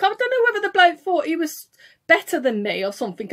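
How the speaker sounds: ordinary talk, nothing marked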